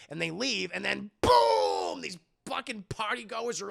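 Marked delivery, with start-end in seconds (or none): 1.2-2.0 loud, long drawn-out blast; imitated explosion, stretched and loud; explosive boom. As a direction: let the word burst and ring out.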